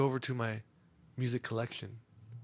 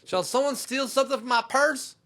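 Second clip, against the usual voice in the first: high, loud mock girl's outcry; accusing, high-pitched party girl; 12 semitones higher, 7 dB louder than usual. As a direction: imitate an outraged hostess stopping the party. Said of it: "She goes".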